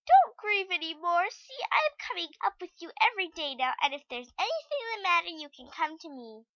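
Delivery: put-on high, tender child consoling; tender girl voice. A narrator's reading. Heidi consoling Snowhopper, tender high girl voice.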